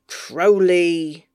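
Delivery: sharp, loud call of his name; angry shout. A narrator's reading. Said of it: "Bees buzzes like an angry fly".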